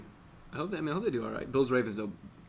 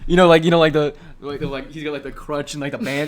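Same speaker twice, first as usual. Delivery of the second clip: laughing; 2 semitones higher, 11 dB louder than usual. Real, not acted. Laughing description of injured player.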